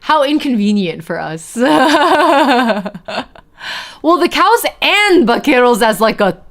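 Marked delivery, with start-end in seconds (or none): 1.6-3.2 bright laugh. Real, not acted. Laughs at own joke.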